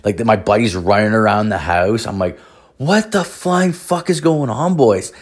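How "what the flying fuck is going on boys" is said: higher, drawn-out incredulous demand; high, slow, acted astonishment; retold bewilderment. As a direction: stretch it out in mock disbelief. Retold shock at chaos in house.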